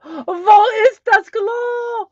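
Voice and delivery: high, loud, slow pleading; hammed-up urgent need to pee; desperate for the toilet. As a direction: beg like you really need the bathroom. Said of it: "say it like I really have to go".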